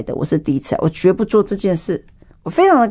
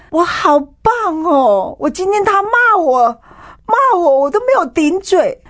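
high, slow, choked-up voice of a woman in tears; tearful prayer; 13 semitones higher, slower than usual. Retold or imitated. Kneeling before the cross after being cursed at, she prays to God in a high tearful voice. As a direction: pray through tears, voice climbing high. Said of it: "跪在那个地方跟神讲说".

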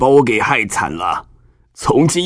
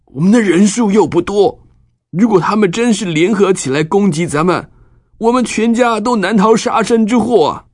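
sad; 10 semitones higher than usual. Acted. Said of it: "难过地对西缅和利未说".

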